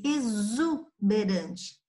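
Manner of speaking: "exuberante" slowly, lingering on the Z syllable "zu"; teacher's drawn-out "exuberante", X sounded as Z; demonstrating the Z sound. Shown as a demonstration. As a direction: break "exuberante" after a voiced Z. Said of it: "já está com som de Z".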